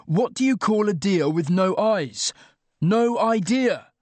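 high, forceful repeat of the joke; exasperated yell. A narrator's reading. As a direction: hammer out riddle and punchline in exasperation. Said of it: "I yelled".

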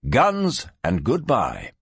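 ordinary delivery, nothing marked

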